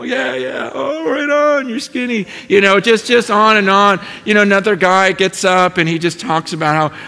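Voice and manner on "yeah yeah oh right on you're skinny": joking friends. Retold or imitated. Voicing the laughing, joking group.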